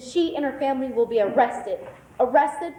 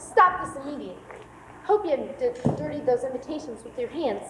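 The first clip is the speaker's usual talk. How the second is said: ordinary talk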